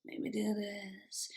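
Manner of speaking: slow, whispered evil menace; menacing. Retold or imitated. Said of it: "it's got that menacing".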